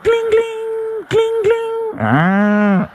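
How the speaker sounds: high sing-song gling of a cow bell; cowbell jingle imitation. Comic imitating a cow bell on a crossing cow. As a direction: ring it out like a bell on a cow's neck.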